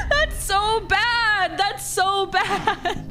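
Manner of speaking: slow, high, drawn-out laughing moan; mock lament. Real, not acted. [name] watching her death cutscene, slow laughing lament. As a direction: wail it while laughing at the disaster.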